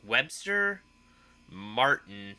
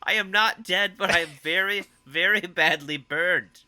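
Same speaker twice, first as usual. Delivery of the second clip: higher, a touch louder imitation of a hurt movie character; Will Ferrell impression; 6 semitones higher, 3 dB louder than usual. Cohost quoting the burned henchman scene, mock cheerful pained voice. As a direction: play it as a cheerfully battered guy insisting he's fine. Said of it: "you just hear him".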